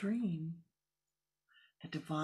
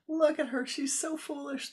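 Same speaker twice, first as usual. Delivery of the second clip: much higher, louder scornful jeer; ridiculing; 10 semitones higher, 6 dB louder than usual. Acted. Fellow Swamis jeering at the young Swami, scornful. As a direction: sneer at how foolish she is.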